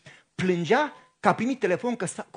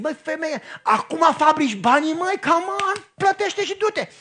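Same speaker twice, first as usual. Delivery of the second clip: higher, louder impatient scolding at the driver; impatient outburst; 7 semitones higher, 5 dB louder than usual. Retold or imitated. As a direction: hurry her up, fed up and half-yelling.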